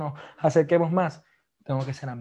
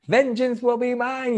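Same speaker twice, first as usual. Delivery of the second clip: higher, slow, hammed-up vengeance line; mock vengeful child; 8 semitones higher, slower than usual. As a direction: play the kid declaring revenge. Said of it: "Aiden ahora dice eso".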